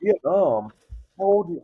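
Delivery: high, incredulous questioning; high-pitched astonished comeback; retold indignant disbelief. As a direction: act out being floored by the question. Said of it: "I got so mad".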